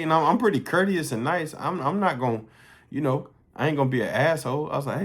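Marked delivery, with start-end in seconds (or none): none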